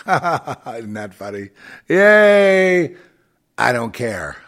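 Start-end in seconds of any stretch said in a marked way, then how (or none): 0.0-1.5 laughing. Real, not acted. Laughs at herself. 1.9-3.0 excited cheer. Real, not acted. Cheering about shouting praise.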